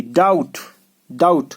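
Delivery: डाउट said as "dout", no B; each डाउट separate, ending on T; silent B. Shown as a demonstration. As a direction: model डाउट with the B left out. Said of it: "बी silent है यहाँ".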